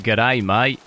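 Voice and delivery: stock Aussie greeting impression; classic Australian accent. Shown as a demonstration. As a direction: put on a cliché Australian accent. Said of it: "I've got the classic".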